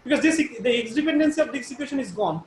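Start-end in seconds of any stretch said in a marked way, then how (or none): none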